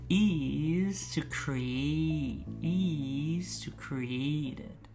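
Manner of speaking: very slow, soft, linked phrase; drawn out slowly, linking each sound; slow linking drill. Shown as a demonstration. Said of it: "clearly pronounce those consonants".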